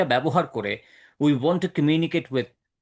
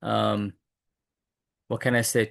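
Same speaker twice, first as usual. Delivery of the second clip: normal talking voice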